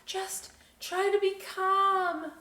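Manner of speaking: high and slow, coaxing himself to stay calm; slow self-soothing. A narrator's reading. Boy on a high ladder telling himself to stay calm, slow and high. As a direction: talk yourself down, drawn out and high. Toby calming himself, read aloud.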